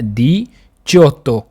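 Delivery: दिचोत्तो drawn out syllable by syllable; a teacher slowing दिचोत्तो down; slow and careful. Shown as a demonstration. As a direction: spell out दिचोत्तो slowly for learners. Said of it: "आपने क्या करना है".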